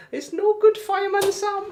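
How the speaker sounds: high, loud cartoon Welsh voice; mock Welsh accent. Streamer mimicking Fireman Sam, mock Welsh accent. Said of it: "do a Welsh accent".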